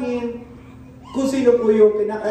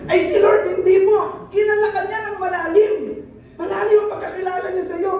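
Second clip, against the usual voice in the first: high, urgent preacher's call; high, fervent sermon delivery; fervent preaching; 10 semitones higher than usual. Acted. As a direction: lift the room with conviction. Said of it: "preaching at full force".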